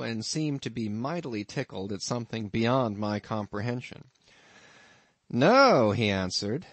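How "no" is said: put-on a raised, loud old innkeeper's "No"; gruff landlord. Innkeeper answering his lodger, loud and high.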